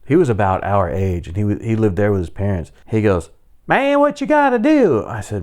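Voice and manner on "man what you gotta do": very high-pitched neighbor caricature; neighbor guy. Retold or imitated. Neighbor asking about the dead dog, high caricatured voice. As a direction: put on a squealing high voice for the neighbor. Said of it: "The guy catty-cornered from us".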